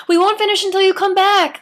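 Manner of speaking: high, loud call after someone leaving; Libby calling after Carrie, high and loud. A narrator's reading. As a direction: call it after him as he goes. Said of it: "Libby called to him".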